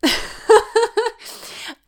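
a sudden high burst of laughter. Real, not acted. Laughing at herself after begging God. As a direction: laugh at her own begging.

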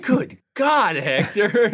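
hammed-up shocked sheriff; mock outraged cry. Sheriff reacting to being called fat, shocked cry. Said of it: "sheriff reacting to fat remark".

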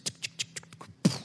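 soft clicking coaster ticks; imitating the coaster climb; whispered ticking. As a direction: tick it out under your breath.

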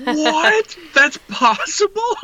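squeaky high, amazed; high-pitched disbelief. Retold or imitated.

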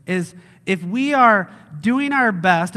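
normal talking voice

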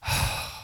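long unvoiced sigh of heartache; a sigh for someone hurting; heavy sigh. Shown as a demonstration. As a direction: let out a sad sigh. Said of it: "this was my response".

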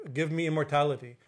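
usual speaking voice

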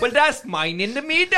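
high, loud mock Welsh punchline; Welsh accent. Irishman mocking his rugby rivals, loud high Welsh accent. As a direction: land it in broad caricature Welsh. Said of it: "in a Welsh accent".

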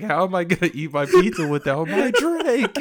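high, loud cartoon outburst; hammed-up, high-pitched cartoon complaint; cartoon quote. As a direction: wail it like an upset cartoon customer. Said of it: "SpongeBob is, is goated".

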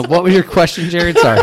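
laughing. Real, not acted. Apologizing while still laughing.

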